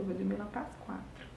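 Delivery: ordinary delivery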